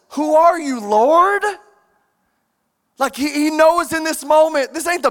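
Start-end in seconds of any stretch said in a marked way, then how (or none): 0.1-1.4 higher, louder startled question; a touch of awe and alarm; mild startled Saul asking the Lord. Acted. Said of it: "Saul says".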